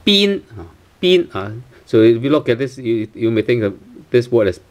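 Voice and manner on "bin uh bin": "bin" high, with a long ee, like been. Shown as a demonstration. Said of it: "long E sound".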